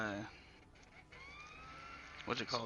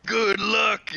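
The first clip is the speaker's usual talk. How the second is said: imitating the RPer; 8 semitones higher, 6 dB louder than usual. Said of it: "He just said".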